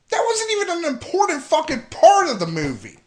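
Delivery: high, loud, indignant rant; exasperated rant. Real, not acted. Fan ranting about a pointless movie secret. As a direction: throw the complaint up in outrage.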